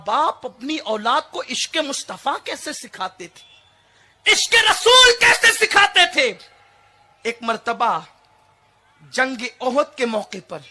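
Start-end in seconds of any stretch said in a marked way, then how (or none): 4.3-6.3 high, loud proclamation at full force; impassioned preaching. Real, not acted. Preacher proclaiming a hadith to the congregation, full-voiced shout. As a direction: thunder it out to the hall.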